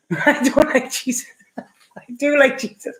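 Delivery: laughing through a playful backtrack; giggling. Real, not acted. Host giggling to live viewers about the Jesus card, laughing. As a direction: giggle as you flip your answer.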